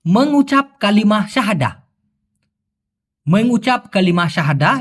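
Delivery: Malay words spelled out slowly, syllable by syllable; carefully sounded Malay sentence; slow Malay phrase. Shown as a demonstration. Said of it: "এটা যদি বলে".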